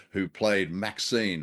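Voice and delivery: ordinary talk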